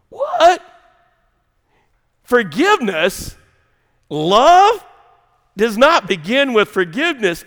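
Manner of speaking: high-pitched, exaggerated disbelief; mock incredulous. Preacher voicing the congregation's objection, mock incredulous. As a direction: balk at the idea, voice swooping up. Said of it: "I know what you all are thinking".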